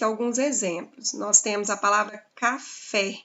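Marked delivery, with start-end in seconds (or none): 2.3-3.2 "café" in separated syllables, weight on "fé"; stress on final syllable. Shown as a demonstration. Teacher showing the class the strong syllable, "café" stressed on "fé". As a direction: sound out "café" with the final "fé" held clearly. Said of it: "A sílaba forte é em fé".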